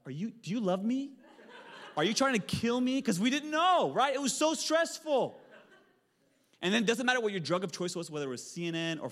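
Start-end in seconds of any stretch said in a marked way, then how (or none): 0.1-3.0 higher, louder wife in a mock panic, laughing; wife shrieking at him, half-joking; mock panicked wife. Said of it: "My wife". 3.0-5.4 loud, high, slowed pulpit storytelling; preacher at full pitch, laughing along; high-pitched preaching build. Acted.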